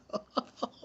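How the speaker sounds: a burst of high-pitched laughter; giggling with glee at the boss being put in her place. Real, not acted. Laughing while retelling his reaction.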